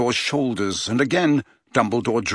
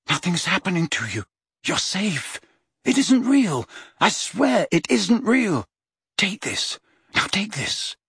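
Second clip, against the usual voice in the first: higher, urgent coaxing; urgent reassurance; 7 semitones higher than usual. A narrator's reading. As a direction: coax him to drink. Harry urging Dumbledore.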